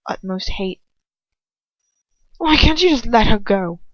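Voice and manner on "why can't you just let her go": loud, fierce demand. A narrator's reading. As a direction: push back at him hard. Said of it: "Ron asks fiercely".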